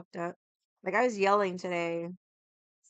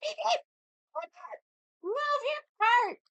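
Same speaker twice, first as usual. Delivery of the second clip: retold yell. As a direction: honk and holler at a blocking cart. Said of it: "Who the fuck is yelling?".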